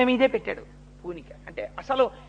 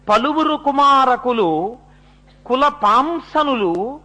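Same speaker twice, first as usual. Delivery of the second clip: verse recitation; 3 semitones higher, 3 dB louder, slower than usual. Acted. Husband speaking to his wife of one worthy son, slow high verse recitation. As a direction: intone the poem with long held vowels.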